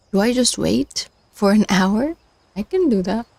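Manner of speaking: ordinary delivery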